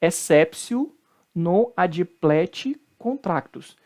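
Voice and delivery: exceptio with T as S, "essépsio", very slowly; correct Latin pronunciation. Shown as a demonstration. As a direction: enunciate exceptio as "essépsio", word by word. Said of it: "do T com som de C".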